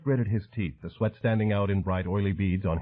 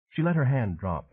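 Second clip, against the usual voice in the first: plain reading voice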